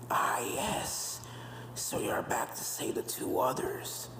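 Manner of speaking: slow eerie whisper; whispering ghost. Acted. Portrait ghosts whisper about the ponies, slow and eerie. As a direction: hiss it like a haunted portrait.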